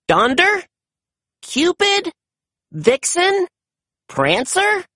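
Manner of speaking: high questioning calls, each rising sharply; tentative high-pitched roll call; guessing names. A narrator's reading. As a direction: try each name hopefully, as a question. Chase tries out reindeer names.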